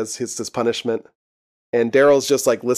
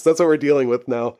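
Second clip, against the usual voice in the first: ordinary talk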